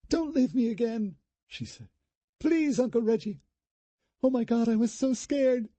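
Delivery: high, tearful plea to her uncle; a scared girl clinging to her uncle; frightened pleading. Acted. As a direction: beg him not to go.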